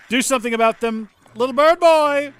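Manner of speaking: frantic high shouting; panicked yelling. Real, not acted. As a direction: yell for help in a panic.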